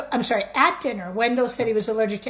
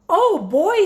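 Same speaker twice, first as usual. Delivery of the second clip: high, loud eager cheer; excited; 8 semitones higher, 7 dB louder than usual. Acted. Wendell thrilled about playing firefighter, loud eager cheer. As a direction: jump at the idea.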